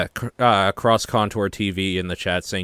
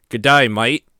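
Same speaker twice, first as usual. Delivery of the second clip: loud joke British accent; British accent that could pass for Australian; mock British accent; 3 semitones higher, 7 dB louder than usual. Shown as a demonstration. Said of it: "Now I'll do a British accent".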